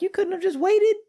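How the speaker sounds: high-pitched incredulous complaint; mock exasperation. Husband to wife calling over small things, mock exasperation. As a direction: squeak it out in comic disbelief. Acted exasperated reply to wife's call.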